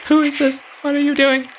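high falsetto questions; squeaky, startled put-on woman; mock wife voice. Wife answering the prank call, high falsetto.

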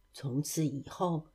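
usual speaking voice

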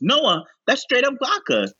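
high, startled remark; shocked Ricky. Retold or imitated. Ricky warning Noah about the neat vodka, high and startled. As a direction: gasp at the drink. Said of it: "Ricky goes".

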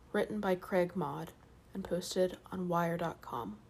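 soft hushed bedtime voice; sleep story narrator. Acted. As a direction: ease them gently toward sleep. Sleep story intro, whispered and soft.

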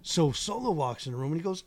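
usual speaking voice

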